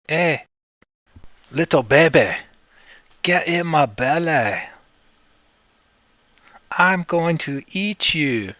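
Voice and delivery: mock greedy voice speaking to the pizza; put-on hungry voice. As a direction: playfully menace the pizza in a put-on voice.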